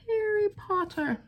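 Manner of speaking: high, playful fake British voice; fake British accent. Crafter naming her stamped bird for viewers, fake British accent. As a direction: put on a mock British accent. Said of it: "said that with my fake British".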